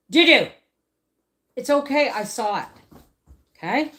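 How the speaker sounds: high, loud call to the dog; firm pet talk. Real, not acted. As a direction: get the dog's attention and settle her. Calming a growling dog.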